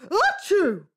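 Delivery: big loud sneeze. Acted. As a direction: sneeze hard. Nanny catches the king's cold.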